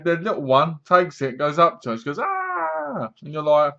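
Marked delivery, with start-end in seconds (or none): 2.2-3.1 high-pitched held "ah"; imitated scream. Little one upstairs in the retold film, imitated scream.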